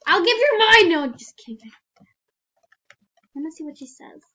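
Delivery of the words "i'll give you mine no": high, loud joking shout; mock loud offer. Joking reply to character.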